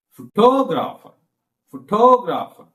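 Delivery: "Photographer" with TO high and long; stress on second syllable. Shown as a demonstration. Teacher repeating demo for class, strong second-syllable stress. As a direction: punch the TO of "Photographer", then fall. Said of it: "Like that".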